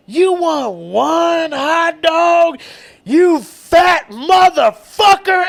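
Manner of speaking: high, slow, drawn-out incredulous yell; really loud. Retold or imitated. Counter woman insults a customer ordering one hot dog, really loud. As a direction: imitate a counter woman roasting a customer at full volume. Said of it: "like, really loud just goes".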